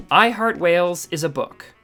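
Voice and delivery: ordinary talk, nothing marked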